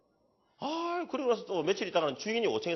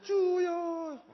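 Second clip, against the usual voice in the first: high drawn cry, lightly imitated; mock prayer cry; 11 semitones higher, much slower than usual. Imitating widows crying to the Lord, mock high cry. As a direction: call out like a wailing widow.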